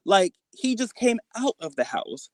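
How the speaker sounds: ordinary talk, nothing marked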